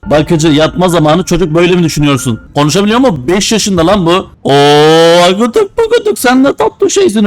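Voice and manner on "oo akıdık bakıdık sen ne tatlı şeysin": put-on high cooing baby talk. Babysitter cooing at the baby in a high voice. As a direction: coo at the baby in a sing-song.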